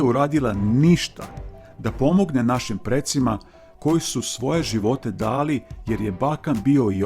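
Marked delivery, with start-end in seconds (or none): none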